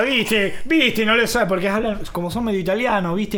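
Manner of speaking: hammed-up Argentine; Argentine accent. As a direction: preen like a smug porteño. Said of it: "Nos están imitando".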